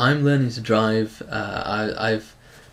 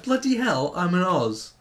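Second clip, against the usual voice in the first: higher, hammed-up Australian accent; 6 semitones higher than usual. Friend recalls surprising himself, hammed-up higher Australian accent. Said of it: "suddenly appeared in this Australian accent".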